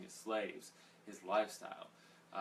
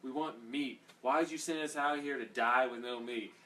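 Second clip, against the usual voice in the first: whining; 5 semitones higher, 5 dB louder than usual. Retold or imitated. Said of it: "they just whine like that".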